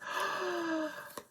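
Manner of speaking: high, delighted gasp; real gasp of happy surprise; surprised gasp. Real, not acted. Surprised by the gift.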